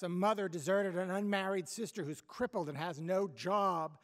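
angry mother. Acted. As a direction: berate him, building to a yell on go go. Mother scolds son, acted quarrel.

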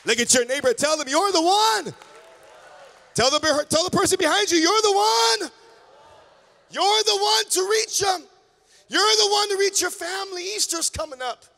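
high, loud rallying shout; full-force preaching. Real, not acted. Preacher charging congregation to reach family, full-force shout. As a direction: shout it out to rouse the whole congregation.

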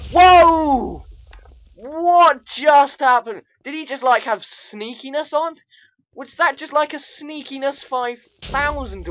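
high, very loud disbelief; startled yelling. Real, not acted. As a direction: shout in shock at a sudden blast. Startled by sudden explosion in game.

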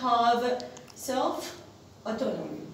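very slow, word by word, with pauses; slow dictation. Shown as a demonstration. Teacher repeating the definition for students, slow dictation.

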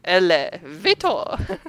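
slow excited shout; drawn-out delighted call. Real, not acted. Excited discovery.

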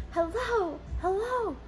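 mock desperate hello on the phone; pleading. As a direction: beg them not to hang up. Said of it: "I'm over here at the other end like".

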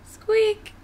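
high squeaky yelp; tiny high-pitched squeak; little lion's squeak. Acted. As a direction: squeak it high like a small animal. Little lion squeaks at Mom.

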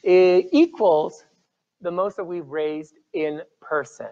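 ordinary delivery

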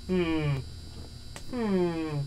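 ordinary talk

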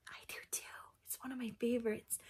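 soft secret whisper. Pretend. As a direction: lean in and whisper it. Whispers to the kids.

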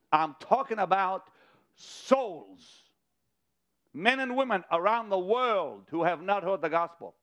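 rally the congregation to the mission; impassioned revival sermon voice; fervent preaching. Acted. Preaching at full force.

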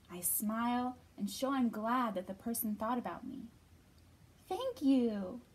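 expressive storybook reading voice; reading to children. Acted. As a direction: read it gently to little kids. Reads picture book to children.

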